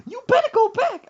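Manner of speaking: high, loud screech; mock angry mama. Mother sending son back to store, screechy mock command. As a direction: screech it as an order.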